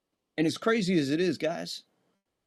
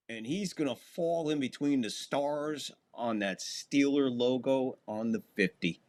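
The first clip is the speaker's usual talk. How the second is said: ordinary delivery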